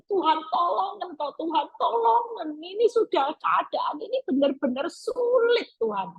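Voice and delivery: high, pleading cry to God; desperate plea. Pretend. Preacher voicing a desperate prayer to God, pleading high. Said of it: "memohon dengan sangat itu dengan segenap hati".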